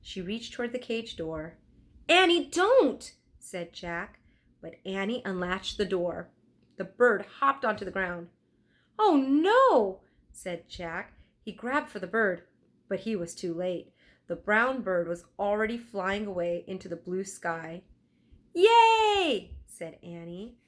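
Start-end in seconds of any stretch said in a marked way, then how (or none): 2.1-3.1 high, loud, slow warning; alarmed warning. Acted. Jack warns Annie. 9.0-10.0 dismayed. Acted. Jack dismayed. 18.5-19.5 high, loud, joyful cheer. Acted. Annie cheers bird freed.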